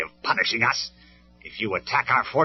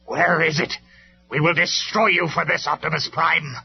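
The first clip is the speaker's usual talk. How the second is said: anxious; 9 semitones higher, 9 dB louder than usual. Acted. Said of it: "more anxious now".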